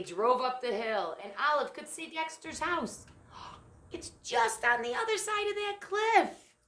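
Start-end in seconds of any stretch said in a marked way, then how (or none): 3.3-6.6 sudden gasp, then high and loud; gasp of alarm. Acted. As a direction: gasp at the danger ahead. Narrator gasps at cliff.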